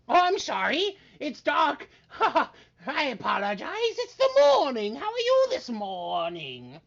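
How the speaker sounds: high, bright showman voice; eager wizard. Acted. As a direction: correct yourself with giddy cheer. Wizard corrects himself.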